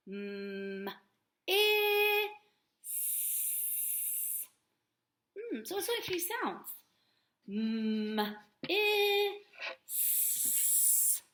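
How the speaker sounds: "M, I, S." each sound held apart, S a hiss; stretching sounds. Shown as a demonstration. Teacher sounds out "miss" for the class, stretched. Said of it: "stretch it out a little bit".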